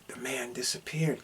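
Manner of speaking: whispered, ghost-story hush; spooky whisper. Acted. Recapping a horror movie, eerie whisper. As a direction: breathe it out like a campfire tale.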